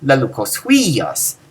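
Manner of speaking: "Lelucos huios" with HUIOS drawn out; stress on huios. Shown as a demonstration. Teacher showing whatever follows the participle gets stressed, huios. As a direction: let "Lelucos" go by and lean slowly on "huios". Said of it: "Whatever follows, you emphasize".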